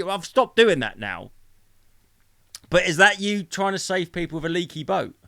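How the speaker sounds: ordinary delivery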